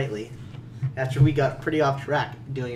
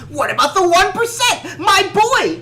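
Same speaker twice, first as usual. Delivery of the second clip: mock Joyce shout; 8 dB louder, much slower than usual. Joyce mockery, louder cry.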